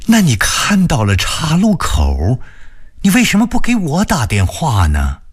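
young woman pleading on the phone, voiced high; anxious woman on the phone, pitched high; high female voice. A narrator's reading. Wenxin voiced in phone story. (高声女声，焦急地问)

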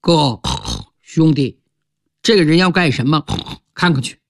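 a hint of two curious pigs talking; pig voices. One pig asks his brother about the stranger, low and quick. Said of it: "引起两头肥猪的注意".

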